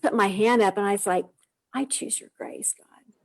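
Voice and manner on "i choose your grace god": softly whispered, breathy surrender; whispered prayer. Retold or imitated. Mother whispering her surrender to God in a whisper.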